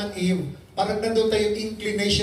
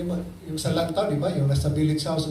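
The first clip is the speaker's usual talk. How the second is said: normal talking voice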